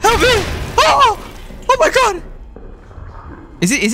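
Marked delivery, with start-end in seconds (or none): 0.0-2.2 panicked screams. Real, not acted. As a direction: scream for help as if being chased. Chased by a monster.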